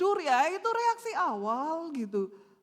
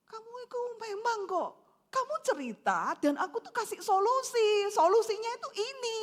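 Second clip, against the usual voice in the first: higher, fast husbandly protest; worked-up, emotional; 6 semitones higher than usual. Acted. Husband insisting to wife he gave a solution, worked-up. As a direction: defend yourself hotly as the acted husband. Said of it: "tersulut emosi".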